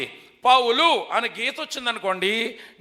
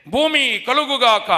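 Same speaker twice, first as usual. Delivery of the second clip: voice of God; 4 dB louder, slower than usual. Acted. Quoting God's command.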